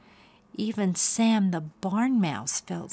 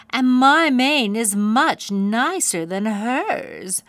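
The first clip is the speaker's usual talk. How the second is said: louder, higher, slow jealous boast; jealous snort; 4 semitones higher, 7 dB louder, slower than usual. A narrator's reading. Jealous pony bragging about her mane, snorting. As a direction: snort it out with envy. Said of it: "snorted Bunbun jealously".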